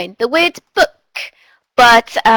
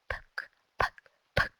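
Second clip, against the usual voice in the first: whisper; 17 dB quieter than usual. Shown as a demonstration. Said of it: "Let's go".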